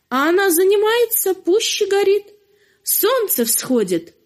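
usual speaking voice

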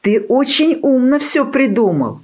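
high-pitched, admiring puppy; delighted praise. A narrator's reading.

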